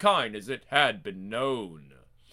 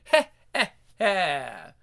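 evil chuckle; 4 dB louder, slower than usual. Acted. Uncle Creepy laughs.

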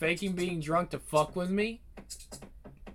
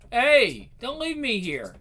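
calling out; 6 semitones higher, 5 dB louder, slower than usual. Acted. Calling after departing friend.

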